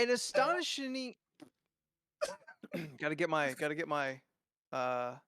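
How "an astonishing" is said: high, hyped announcer start. Pretend. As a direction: boom it like a fight announcer. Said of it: "announcer voice back on".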